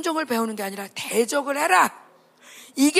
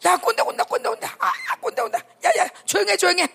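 kids' mock warning; 6 semitones higher than usual. Kids warn old fogey comes.

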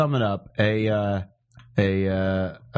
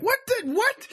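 much higher, squeaked stammering disbelief; stunned stammer, way up high; mock shock; slower than usual. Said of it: "You're like".

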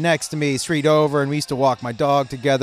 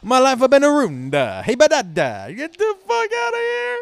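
high, hammed-up Italian rant; mock-furious cartoon Italian, raised pitch; excessive Italian accent; 10 semitones higher than usual. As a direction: rant like an outraged Italian dad, climbing higher. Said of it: "yelling in an excessively Italian accent".

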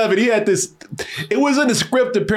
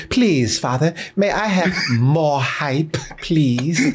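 slow, lower British plea; British accent; 4 semitones lower, slower than usual. Acted. Host begging a father for more hype, mock British plea. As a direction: beg politely in a put-on British accent. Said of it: "British accents".

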